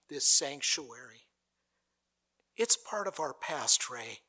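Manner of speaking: ordinary delivery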